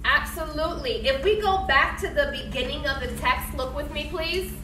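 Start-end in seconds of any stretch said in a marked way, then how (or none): none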